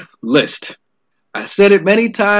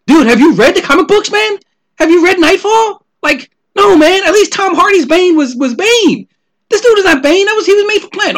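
much higher, louder mock voice of an insistent fan; Bizarro Joe impression; 12 semitones higher, 3 dB louder than usual. Shipmate at concessions pressing his Bane opinion, high mocking fan voice. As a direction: play the pushy movie-theater guy defending Bane.

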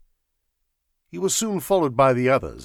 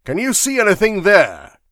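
raised, louder impatient question; 10 semitones higher, 11 dB louder than usual. A narrator's reading. Sir Walter calling up to the ledge, impatient. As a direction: call up with growing impatience. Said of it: "asked Sir Walter impatiently".

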